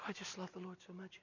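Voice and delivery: barely audible, meek murmur; soft-spoken imitation. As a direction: imitate a timid man, almost too soft to hear. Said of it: "Some real soft-spoken guys".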